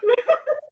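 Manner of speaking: short laugh. Real, not acted. Laugh after joke.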